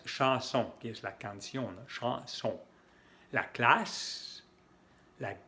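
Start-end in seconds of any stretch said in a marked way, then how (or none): 0.0-0.7 teacher models chanson for the class; French chanson. Shown as a demonstration. French chanson sounds. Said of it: "por esas palabras". 1.9-2.5 Chanson repeated, nasal on-sound; teacher repeats Chanson for students; French chanson. Shown as a demonstration. Nasal French Chanson. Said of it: "por esas palabras".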